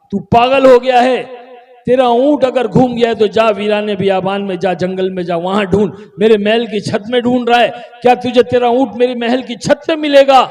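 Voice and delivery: loud, high, rapid-fire rebuke; acted exasperated tirade; angry scolding. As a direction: berate the fool as an affronted king. King scolds the camel man.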